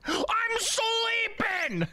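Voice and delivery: squeaky high shout; high shouted whine. Acted. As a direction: whine it out, drawn long and high. Voicing sleepy DK reply.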